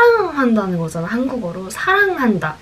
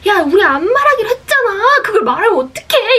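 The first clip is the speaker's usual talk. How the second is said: high-pitched re-enacted angry yelling; 11 semitones higher, 4 dB louder than usual. Girl chases friend who broke promise, angry. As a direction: scold while chasing, voice pitched way up. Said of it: "이러면서 막 화를 내면서".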